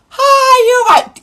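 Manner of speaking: imitating her own overly nice self; sweet polite greeting. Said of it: "I try again".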